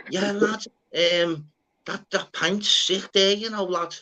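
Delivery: high, put-on Scouse lad voice, slow on the pint line. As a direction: mimic a chirpy Scouser chatting at a bar. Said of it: "I'm like".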